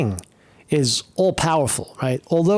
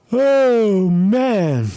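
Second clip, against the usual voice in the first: surprised oh man; 8 semitones higher, 4 dB louder than usual. Real, not acted. Reacts to photo.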